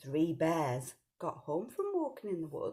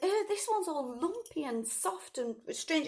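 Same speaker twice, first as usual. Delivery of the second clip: higher, a hint of a fussy little girl; lightly voiced whiny girl; Goldilocks; 10 semitones higher than usual. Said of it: "and she went".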